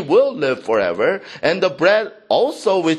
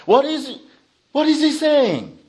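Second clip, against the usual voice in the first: baffled crowd; 7 semitones higher than usual. Acted. Crowd baffled by Jesus' words.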